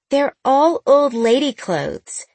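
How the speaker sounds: put-on high, slow whiny teen voice; complaining teen girl. A narrator's reading. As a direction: whine it like a sulky teenager. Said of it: "she complained".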